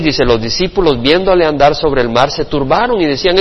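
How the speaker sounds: ordinary talk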